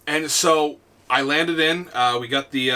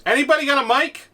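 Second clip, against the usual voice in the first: lightly voiced, higher kid's question; quick pestering kid, pitched up; imitated gamer kid; 10 semitones higher, faster than usual. Kid asks his teammates for a mic, imitated pestering. Mimics kids on voice chat.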